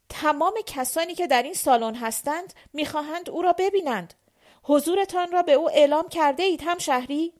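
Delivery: forceful, high, officious questioning; brusque guard. A narrator's reading. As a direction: challenge them loudly, like an official on duty. Brusque guard challenges the petitioners.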